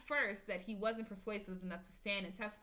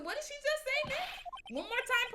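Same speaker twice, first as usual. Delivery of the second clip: much higher excited sidekick voice; high girl voice; 5 dB louder than usual. Acted. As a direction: squeak it up high with urgency. Maya asking parrot again.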